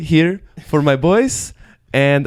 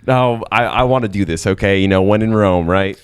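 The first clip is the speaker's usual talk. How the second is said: lower, put-on Southern California American accent; 7 semitones lower than usual. Photographer insists on doing it, low put-on American accent. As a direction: keep up the assumed American accent, pitched down. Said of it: "I'm going to do my American accent".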